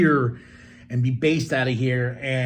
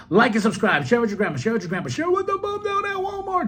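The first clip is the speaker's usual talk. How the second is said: outro plug; 9 semitones higher than usual. Real, not acted. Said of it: "outro plug".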